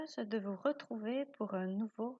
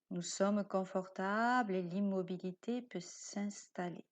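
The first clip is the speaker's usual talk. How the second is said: guided meditation. Acted. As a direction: lull the listener towards sleep. Guide une séance de yoga nidra. (voix de méditation guidée, lente et douce)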